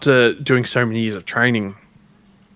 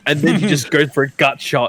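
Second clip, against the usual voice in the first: ordinary talk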